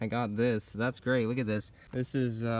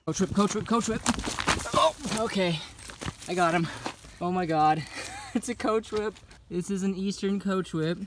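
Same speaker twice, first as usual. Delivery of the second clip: high, loud thrilled yelling; excited shouting; 8 semitones higher, 4 dB louder than usual. Real, not acted. Spots and catches a coachwhip.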